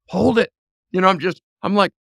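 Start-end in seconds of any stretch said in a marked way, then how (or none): 0.1-0.5 raised, higher urgent command; urgent order. Retold or imitated. Dad calling to his daughter in the crawl space, loud urgent order. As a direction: bark it out so she won't let go.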